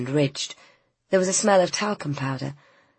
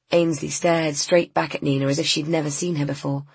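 plain reading voice

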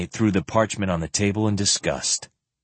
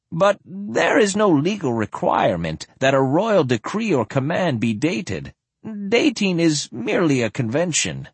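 put-on higher elderly lord voice; a precise old noble explaining; old man voice; 8 semitones higher than usual.

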